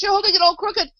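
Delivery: high-pitched, loud alarm; crying out. A narrator's reading. Alice warns the Queen about the brooch, crying out. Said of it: "cried Alice".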